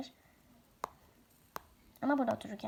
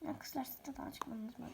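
whisper; 13 dB quieter than usual. Real, not acted. Hushed aside to the girls.

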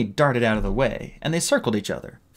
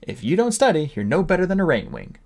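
teacher; 7 semitones higher than usual. Retold or imitated. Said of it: "The teacher said things like that".

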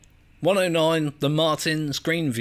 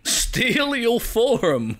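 drawn-out, higher parroting, very slow; mocking echo; 6 semitones higher, much slower than usual. Player echoing the game's line, amused drawn-out mimicry. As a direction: stretch the words out in mock imitation.

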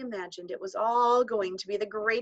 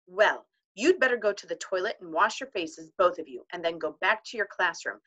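ordinary delivery, nothing marked

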